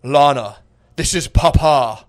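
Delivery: hammed-up grand entrance as Papa; solemn dad voice.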